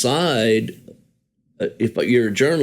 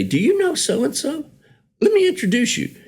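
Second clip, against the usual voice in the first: much higher, eager mock voice of a friend making introductions; imitating Peter. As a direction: play the keen host, pitched way up. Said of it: "he would say".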